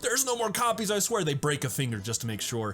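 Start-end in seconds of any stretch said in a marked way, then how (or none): 0.0-1.2 begging. Retold or imitated. Victim begging for his life.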